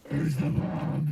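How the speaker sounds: slow, loud Batman growl; gruff Batman voice. Pretend. Batman interrogation impression.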